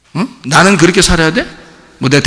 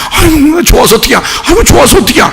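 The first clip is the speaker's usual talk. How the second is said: high, loud hammed-up gushing; mock doting cry; 10 semitones higher, 4 dB louder than usual. Pastor mocking a believer who dotes on family, high and gushing.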